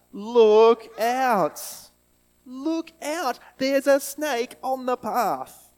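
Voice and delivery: loud, high cry about the snake; yelled warning. Shown as a demonstration. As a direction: shout to warn a friend of a snake. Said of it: "we want to call out".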